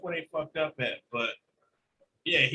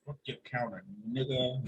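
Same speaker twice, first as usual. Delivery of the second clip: cat's menacing voice; 6 semitones lower, 7 dB quieter than usual. Retold or imitated. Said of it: "stare at you or stare at me and be like".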